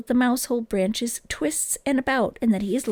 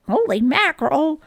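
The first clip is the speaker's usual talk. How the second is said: surprised; 5 semitones higher, 5 dB louder than usual. A narrator's reading. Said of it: "who was just almost as surprised".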